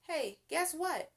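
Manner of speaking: bright excited call; calling out the news; shouted. A narrator's reading. Boy shouting news to his family, excited. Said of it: "shouted Arthur".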